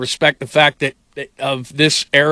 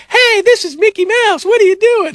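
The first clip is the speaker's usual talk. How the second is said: squeaky, much higher cartoon mouse voice, slowed; mock Mickey Mouse; 5 dB louder than usual. Disney bosses scolding him in a Mickey Mouse voice. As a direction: play a scolding Disney mascot. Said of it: "some people going".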